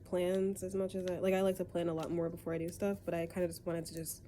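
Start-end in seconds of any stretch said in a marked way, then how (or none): none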